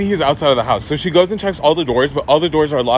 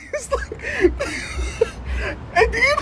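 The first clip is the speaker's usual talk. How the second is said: much higher, helpless laughter breaking the words; laughing fit; 5 dB quieter, slower than usual. Real, not acted. Laughing too hard to finish mom's story. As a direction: crack up mid-sentence.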